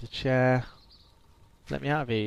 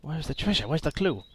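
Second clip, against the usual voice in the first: usual speaking voice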